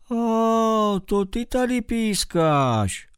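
slow, unhurried mole voice; mole's voice. Acted. Mole answers the squeaking bat, slow and unhurried. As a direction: draw it out like a sleepy little digger. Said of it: "ozvalo se to".

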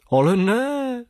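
high, startled cry; surprised exclaim. Acted. Professor recognizing the bat, surprised exclaim. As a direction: blurt it out in amazement.

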